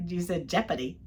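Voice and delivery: usual speaking voice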